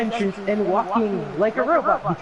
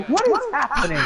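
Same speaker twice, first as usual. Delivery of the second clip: laughing disbelief; 7 semitones higher, faster than usual. Retold or imitated. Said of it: "I'm like".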